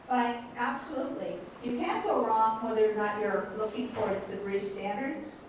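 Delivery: ordinary talk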